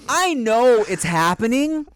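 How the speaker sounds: exasperated plea. Retold or imitated. Pleading with dad.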